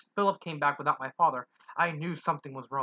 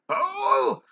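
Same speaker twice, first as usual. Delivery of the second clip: loud, drawn-out monster roar; a giant creature roaring for Belle; 4 semitones higher, 6 dB louder, much slower than usual. Acted. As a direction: bellow it across the room. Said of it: "the voice roared again".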